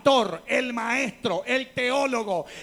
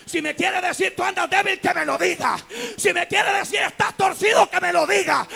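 louder and higher with each line; full-force pulpit shout; preaching crescendo; 8 semitones higher, 8 dB louder than usual. Acted. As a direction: climb to a shouting peak. Building climax of demands.

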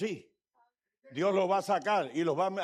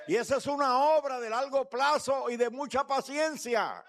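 rising, louder preacher's cadence building; fervent preaching; 6 semitones higher, 3 dB louder than usual. Acted. Pastor preaching on God's patience to his church, fervent and louder. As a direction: preach it at full force, higher and louder.

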